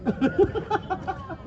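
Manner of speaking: soft high chuckle; quiet, high laughter. Real, not acted. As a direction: giggle quietly to yourself. Laughing at the scolded coach.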